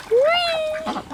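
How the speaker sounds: very high gleeful whee; playful high-pitched whee at the excited ducks; high squeal. Real, not acted.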